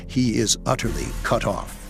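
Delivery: plain reading voice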